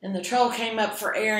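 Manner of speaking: ordinary delivery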